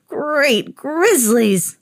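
very slow, drawn-out mock moan. A narrator's reading. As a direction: stretch it out in mock despair.

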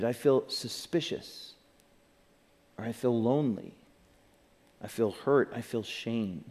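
soft, low, with long pauses; name each feeling quietly, as if to yourself; quiet self-admission. Shown as a demonstration. Models naming one's emotions.

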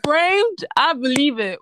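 laughing through the words. Real, not acted. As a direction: giggle while insisting. Laughing while defending.

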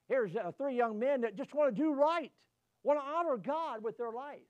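full-force preaching, loud and rising. Acted. Pastor warning his flock, preaching hard. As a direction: drive it home to the congregation.

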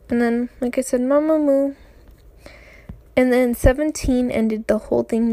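ordinary talk

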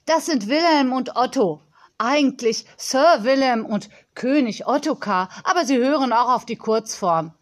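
put-on crisp, emphatic girl's voice; self-important young girl. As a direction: play a confident little girl showing off.

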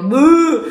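loud high disgusted buh. Retold or imitated. Reacting to the smell of feet in class, loud disgusted buh. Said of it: "Y yo".

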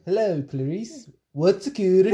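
high, lilting attempt at Welsh; a badly faked Welsh Hannibal Lecter; bad Welsh accent. Shown as a demonstration. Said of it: "I can't do a Welsh accent at all".

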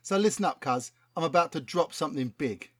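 lightly voiced London rapper, keen; a hint of a slangy London rapper; street rapper voice. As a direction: talk like a cocky MC pitching a hit. Plays rapper Chronic Cortex.

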